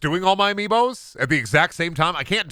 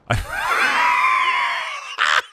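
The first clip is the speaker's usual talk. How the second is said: high yelping laugh; wild cackling shriek. Real, not acted. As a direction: burst into a squealing shriek of laughter. Goodbye after launching himself.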